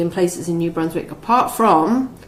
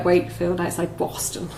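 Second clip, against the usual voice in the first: soft, half-hearted Boston accent; attempted Boston accent; 6 dB quieter than usual. Shown as a demonstration. Host trying a Boston accent for co-host, half-hearted. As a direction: try out a Boston accent on the place names. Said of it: "Do a whole sentence".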